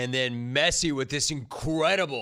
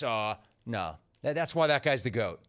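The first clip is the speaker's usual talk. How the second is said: ordinary talk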